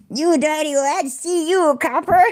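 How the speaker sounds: high, put-on American gangster accent; mock American gangster. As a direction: ham up an American movie gangster. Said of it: "That's what I based it on".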